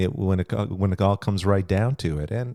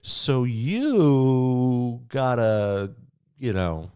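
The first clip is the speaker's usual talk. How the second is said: slow, drawn-out mock hinting; score-keeping partner; slower than usual. Partner tallying chores at the other, slow mock point-scoring.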